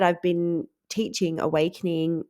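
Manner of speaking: ordinary talk, nothing marked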